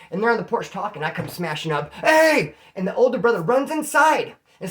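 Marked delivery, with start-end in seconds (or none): 1.9-2.6 loud, high barked "Hey!"; angry shout. Retold or imitated. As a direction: storm up and yell. Storming up to the porch.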